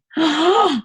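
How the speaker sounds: a sharp high gasp, as if unable to breathe; imitated gasp for air. Woman showing listeners how she gasped for air on waking. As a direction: replay the choking gasp from the dream. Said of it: "it was like I couldn't breathe".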